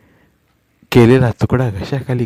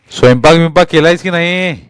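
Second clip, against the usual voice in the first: loud, higher angry shout; 5 semitones higher, 5 dB louder than usual. A narrator's reading. Husband shouting about the cooking, angry yell. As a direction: yell the question at her. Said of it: "तो ओरडला".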